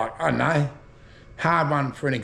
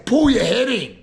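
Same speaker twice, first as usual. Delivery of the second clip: higher and louder, a sharp settle-down; raised telling off; 7 semitones higher, 5 dB louder than usual. Retold or imitated. Mate telling a stirring bloke to settle down, raised voice. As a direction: tell the bloke to pull his head in, loud. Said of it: "You say".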